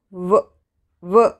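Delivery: വ് said twice, isolated w sound; teacher modelling the wh sound. Shown as a demonstration. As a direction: model വ് for the class. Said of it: "W plus H".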